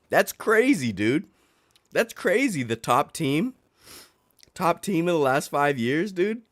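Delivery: high mocking exclamations; sarcastic disbelief. Real, not acted. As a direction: scoff at the coincidence. Sarcastic rant about bandwagon kid.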